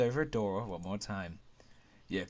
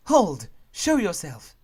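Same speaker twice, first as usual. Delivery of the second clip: loud sharp command, much higher; commanding shout; 7 semitones higher, 12 dB louder, slower than usual. Acted. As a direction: shout the challenge. Guard challenges hidden figure.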